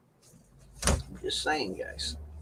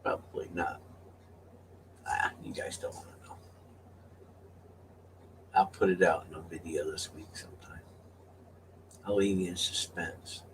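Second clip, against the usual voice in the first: soft whisper, much lower; teasing whisper; 8 semitones lower, 15 dB quieter than usual. Real, not acted. Teasing viewers about the secret giveaways, whispered.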